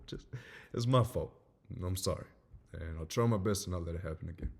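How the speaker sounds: normal talking voice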